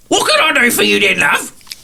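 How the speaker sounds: put-on chirpy old dear sizing up a guest; tottery old lady. Old lady asking the visitor what he wants, high and quick. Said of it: "She looks at you and goes".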